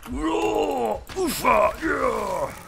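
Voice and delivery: high, drawn-out mock battle cries; Viking war roars. Player plays a raiding Viking, hammed-up roars. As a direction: roar like a pretend raider charging in. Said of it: "that's my Viking voice".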